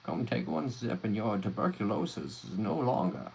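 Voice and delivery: loud, pushy huckster pitch; snake oil salesman. Pretend. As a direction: sell a miracle cure to a crowd. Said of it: "the snake oil salesman".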